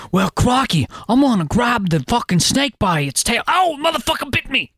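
mock Aussie accent, climbing in pitch as it goes; hammed-up Australian adventurer accent; Australian crocodile hunter. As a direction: reckless Australian wildlife host, ending in a high yelp. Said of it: "I can't divorce that accent from".

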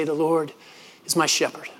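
a mild hint of an old man's cracked voice; quietly reciting like a frail old man; trembling old voice. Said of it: "with a trembling voice that's sort of cracked by time".

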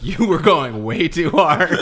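laughing it out, slow and loud; laughing while quoting. Retold or imitated. Retelling the key grip's warning while laughing. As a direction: quote the grip through laughter.

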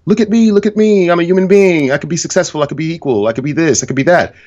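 high-pitched mocking plea, gradually easing down; mock attention seeker. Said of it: "they spend more time playing the".

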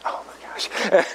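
slow exasperated whisper; whispered oh my gosh at the counter; whispered dismay. Retold or imitated. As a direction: breathe it out in disbelief. Card fails at the counter.

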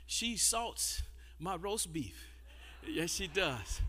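soft, low, slow fond teasing; teasing tenderness. Real, not acted. Preacher fondly teasing his wife before the church. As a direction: tease her gently and lovingly.

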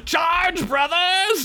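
battle cry shout; rally the troops, yelling. A narrator's reading. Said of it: "General Sherman Croaks shouted".

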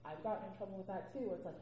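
normal talking voice

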